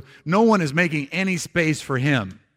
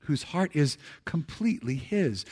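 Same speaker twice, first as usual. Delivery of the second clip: a softer, hushed repeat of the line; soft echo; 5 dB quieter than usual. Shown as a demonstration. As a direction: let it settle back quietly. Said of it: "would you read these last words with me".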